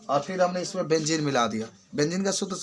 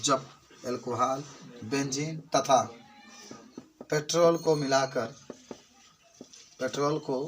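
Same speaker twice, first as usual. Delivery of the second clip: dictation; 3 semitones lower, 6 dB quieter than usual. Acted. Dictating notes slowly.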